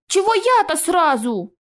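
slow, high-pitched protest; indignant. Acted. Naive Naev objects to being singled out, indignant. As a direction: whine in innocent indignation. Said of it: "возмутился наш наивный друг".

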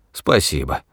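low, grudging thanks; resentful, muted thanks; through the teeth. A narrator's reading. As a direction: squeeze the word out low. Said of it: "процедил старец".

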